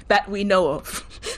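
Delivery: slow drawn-out. Acted. Teasing reply, very slow.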